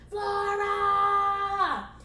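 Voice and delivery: high, loud drawn-out holler; furious boss yelling for staff; bellowed summons. Acted. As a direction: bellow the assistant's name impatiently.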